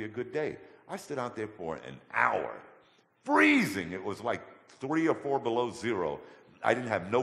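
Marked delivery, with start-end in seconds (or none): 3.2-3.9 sudden high loud cry of freezing; cried out. Retold or imitated. Telling the pastor how cold it was, high yelp. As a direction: yelp the word out like the cold still bites.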